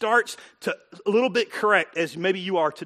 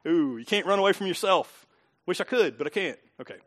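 ordinary talk, nothing marked

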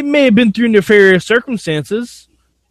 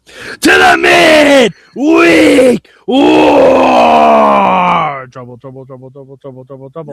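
shouted show intro; 6 dB louder, slower than usual. Pretend. Co-host kicking off the midweek war show, shouted intro.

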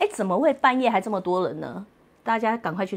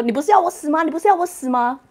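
high, louder mock sulky comeback; husband's retort; 8 semitones higher, 5 dB louder than usual. Husband sulking back at wife, high mock whine. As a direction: sulk back at her, hammed up. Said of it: "他说".

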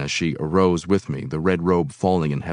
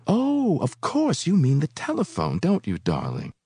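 much higher, sing-song coy voice; coy woman; 8 semitones higher than usual. A narrator's reading. Woman mocking his fear of the phone, high coy tone. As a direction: play the teasing seductress.

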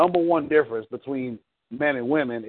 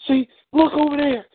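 high-pitched, urgent call; panicked wife; 12 semitones higher, 4 dB louder than usual. Acted. Wife shows husband the danger, in high panic. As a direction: cry out as the frantic wife.

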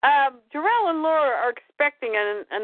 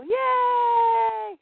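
high, long-held yay; playful cheer; 11 semitones higher than usual. Pretend. Podcaster greeting Krypto in recap, playful cheer.

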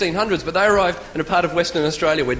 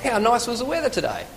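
high voice falling away on "today"; high, chatty question dropping on "today"; rhetorical question; 4 semitones higher than usual. Shown as a demonstration. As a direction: exclaim it as a question needing no answer. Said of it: "We ask questions that don't need answering".

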